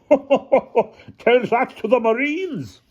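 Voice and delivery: very high, slow heckler's shout; shouted jeer. A narrator's reading. Villager heckles Hannah from down the hill, high shout. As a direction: call it out high and drawn out. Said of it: "someone shouted".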